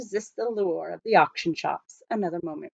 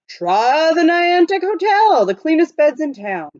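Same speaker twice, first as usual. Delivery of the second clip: hotel runner calling; 8 semitones higher, 5 dB louder than usual. A narrator's reading. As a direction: sell the hotel at full voice.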